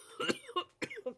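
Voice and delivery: coughing after swallowing wrong; sharp cough from choking. Real, not acted. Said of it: "j'ai avalé de travers".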